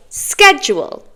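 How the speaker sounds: schedule with a hard k, SKED-jool; speaker's own pronunciation. Shown as a demonstration. British teacher gives their own version, hard-k pronunciation. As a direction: say schedule starting "sk". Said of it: "I'm a British native speaker".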